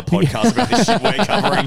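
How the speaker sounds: laughing. Real, not acted. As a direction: admit it through laughter. Laughs at own confession.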